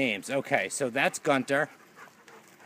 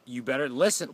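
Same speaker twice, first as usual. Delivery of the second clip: ordinary delivery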